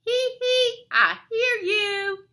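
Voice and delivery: high, loud taunting bat; gloating sing-out in a high bat voice; squeaking bat. A narrator's reading. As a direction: squeak it slowly with a high titter. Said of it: "squeaks Bad Egg".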